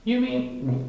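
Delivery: high timid Piglet voice; a scared little pig; nervous gulp. A narrator's reading. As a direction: Piglet frightened of the dark. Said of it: "gulped Piglet".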